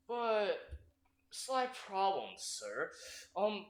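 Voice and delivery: soft, slow, hesitant aide; timid objection. Acted. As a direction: nervously raise a concern.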